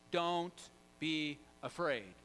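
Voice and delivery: slow, spaced-out delivery; drawn-out, deliberate quote; measured reassurance. Retold or imitated. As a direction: give each word its own weight. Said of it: "we play that in juxtaposition".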